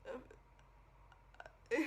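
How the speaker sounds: imitating failed attempts at a word; stuck, halting syllables with long gaps; struggling to speak. Recalling trying to say a word, choked and halting. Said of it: "try to say a word".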